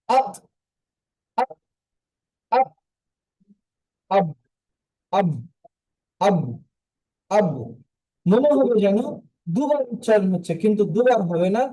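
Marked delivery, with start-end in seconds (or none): 0.1-7.5 আক and আব with a bounce on the final stop; echoing final stop; qalqala demo. Shown as a demonstration. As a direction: let the final ক and ব rebound once. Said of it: "এই হরফটি দেখেন".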